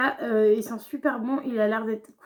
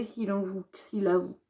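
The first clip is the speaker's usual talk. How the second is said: quick, lower-pitched false starts; stumbling; 4 semitones lower, 6 dB quieter than usual. Real, not acted. Vlogger recounting asking her partner to test it, stammering. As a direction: trip over the words and restart. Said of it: "j'ai bégayé".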